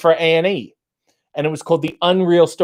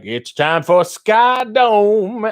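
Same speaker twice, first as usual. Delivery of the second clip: high, drawn-out showbiz announcing; mock grand opening, stretched slow and high; imitating TV hosts; 7 semitones higher, 3 dB louder, much slower than usual. Mimicking hosts opening the Sky Dome special, grandly drawn out. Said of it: "And they're like".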